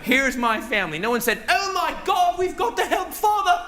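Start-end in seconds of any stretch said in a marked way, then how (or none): 1.5-3.6 high, hammed-up alarm; mock concern. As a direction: fake worry for the dad on TV.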